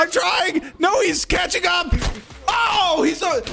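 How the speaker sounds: high panicked shouting; being chased. Real, not acted. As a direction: cry out as he gains on you.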